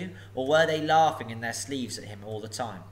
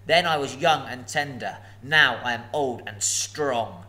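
gloating dragon; 5 dB louder than usual. Acted. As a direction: savour every threat. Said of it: "he gloated".

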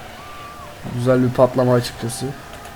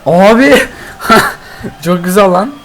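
laughing; 5 semitones higher, 4 dB louder than usual. Real, not acted. Laughing at a great goal.

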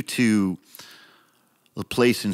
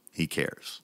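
"He cares" whispered, soft and low; 5 semitones lower, 10 dB quieter than usual. Shown as a demonstration. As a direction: breathe the words out like a secret.

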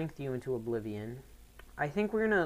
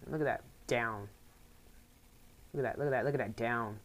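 usual speaking voice

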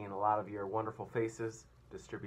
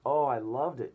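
delighted; 5 semitones higher, 12 dB louder than usual. Acted. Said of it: "James answered".

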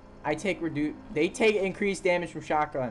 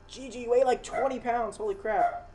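surprised; 8 semitones higher than usual. Real, not acted. Lifting heavy dog.

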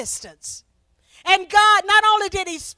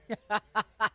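short, low chuckle; brief spontaneous laugh; real laugh; 8 semitones lower, 9 dB quieter than usual. Real, not acted. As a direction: laugh quietly to yourself mid-sermon. Preacher overjoyed by her own point.

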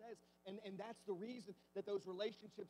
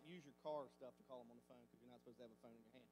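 quick aside; 9 semitones lower, 10 dB quieter, faster than usual. Retold or imitated. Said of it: "And I'm like".

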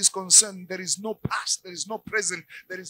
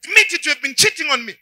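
furious shouted demand, much higher and louder; angry wife; 7 semitones higher, 10 dB louder than usual. Acted. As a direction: scream the accusation at him. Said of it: "She comes now annoyed, angry".

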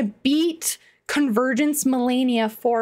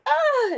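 sudden high-pitched cry of dismay; high yelp. Retold or imitated. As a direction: yelp in shock. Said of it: "and I was like".

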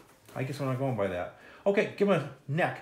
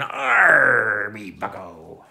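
pirate captain growl; 4 semitones lower, 9 dB louder, much slower than usual. Acted. Pirate captain voiced in drawing lesson.

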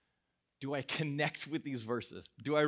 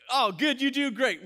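pleased surprise; 11 semitones higher, 10 dB louder than usual. Real, not acted. Pleased reaction to audience.

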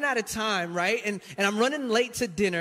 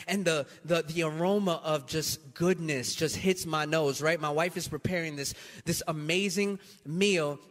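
ordinary delivery

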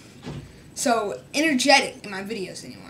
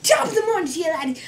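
high, loud Irish YouTuber greeting; Jacksepticeye Irish greeting; 6 semitones higher, 3 dB louder, faster than usual. Retold or imitated. Vlogger imitating another YouTuber's Irish intro, high and loud. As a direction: belt it out Jacksepticeye-style in Irish. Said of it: "I'm not Jacksepticeye".

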